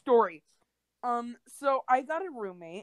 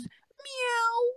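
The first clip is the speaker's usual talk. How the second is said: high squeaky imitated meow; mock cat meow. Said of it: "and he goes".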